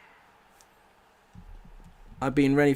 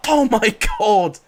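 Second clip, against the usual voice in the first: much louder, slow and indignant; outraged cry; 8 semitones higher, 13 dB louder than usual. Real, not acted. Gamer outraged at the referee, loud and slow. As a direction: blow up at the ref's decision.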